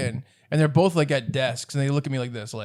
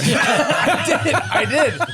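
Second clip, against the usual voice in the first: laughing admission, higher and louder; 10 semitones higher, 5 dB louder, much slower than usual. Real, not acted. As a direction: own up through a laugh.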